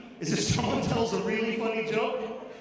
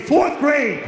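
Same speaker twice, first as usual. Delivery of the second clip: loud, higher, laughing outrage; indignant exclaim; 4 semitones higher, 9 dB louder than usual. Real, not acted. Comic repeating the punchline to the crowd, laughing loud outburst. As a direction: blurt it in mock disbelief.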